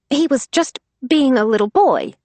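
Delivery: nervous, stammering excuse; stammered. Acted. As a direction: fumble for an excuse. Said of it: "Cooper's mother stammered".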